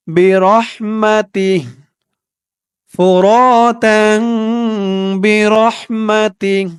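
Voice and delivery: drawn-out chanted syllables; slow recitation. Shown as a demonstration. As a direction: stretch each word slowly like recitation. Slow recited reading of the words. (ধীরে টেনে তিলাওয়াত)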